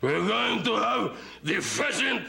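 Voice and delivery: a plummy club host, slow and lofty; pompous host. Retold or imitated. Comedian mimics John Mills as a slow, grand English host. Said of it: "he's like the Sydney Grinch".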